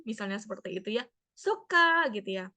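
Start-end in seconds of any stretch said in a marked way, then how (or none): 1.3-2.1 put-on higher, quick bright child reply; a hint of a small child; child answering. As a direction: answer like an eager kid. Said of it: "bertanya kepada anaknya".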